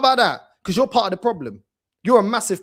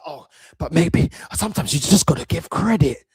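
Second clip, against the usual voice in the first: mocking no-bass voice; 5 semitones lower than usual. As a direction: lower put-on breathy whisper voice. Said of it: "Put some bass in your voice".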